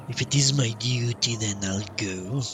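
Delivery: ordinary talk, nothing marked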